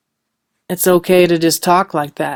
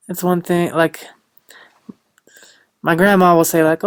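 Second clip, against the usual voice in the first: usual speaking voice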